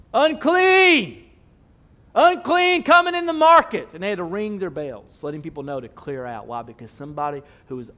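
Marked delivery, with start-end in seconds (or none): 0.1-3.9 high, loud town-crier call; acted warning shout. Leper warning the townspeople, shouted. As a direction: shout it out to clear the street. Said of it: "they had to shout".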